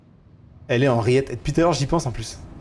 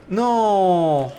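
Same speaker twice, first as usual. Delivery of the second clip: dismayed cry; 4 semitones higher, 4 dB louder than usual. Real, not acted. Killed by the witch.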